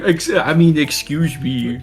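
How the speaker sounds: lightly voiced nerdy kid, slow; prim, slow excuse me; nerd voice. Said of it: "You sound like the guy with the glasses".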